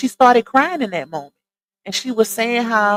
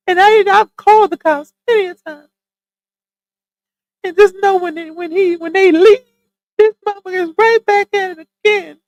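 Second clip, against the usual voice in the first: much higher, louder tearful imitation; acting her crying; 10 semitones higher, 3 dB louder than usual. Streamer acting out the crying woman, tearful and high. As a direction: act out her sobbing story, pitched way up. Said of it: "Let me get in character".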